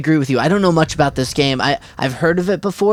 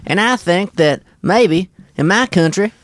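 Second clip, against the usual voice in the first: slow, higher-pitched Southern accent; tighter, higher Southern voice; 5 semitones higher than usual. Shown as a demonstration. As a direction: pitch the Southern twang up and take it slowly. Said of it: "high pi-, more high pitched".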